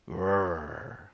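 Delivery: bear growl. Acted.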